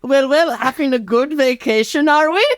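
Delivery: high-pitched German man voice; German villain. Acted. German man surprises Michael from behind, high villainous gloating. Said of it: "behind Michael appears the German man".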